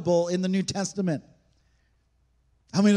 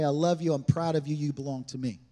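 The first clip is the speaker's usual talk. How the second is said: tender blessing; 5 semitones lower, 4 dB quieter than usual. Acted. A parent blessing a child, tender and low. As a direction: lower and softer, gently to a child.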